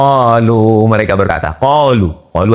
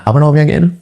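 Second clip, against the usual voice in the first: ordinary delivery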